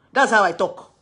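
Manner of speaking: loud self-justifying insistence. Retold or imitated. Woman defending her shouting to a boyfriend, loud voice. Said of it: "we have loud voice".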